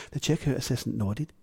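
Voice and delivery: ordinary delivery, nothing marked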